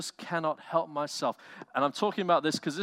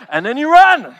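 much higher, loud shouted "run"; preacher's loud, high cry to run; emphatic shout; 13 semitones higher, 17 dB louder than usual. Acted. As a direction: yell it like a coach ordering a sprint. Urging flight from temptation.

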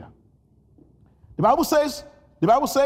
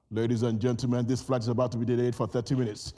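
pilot announcement; 10 semitones lower, faster than usual. Retold or imitated.

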